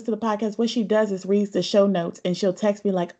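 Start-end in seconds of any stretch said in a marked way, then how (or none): none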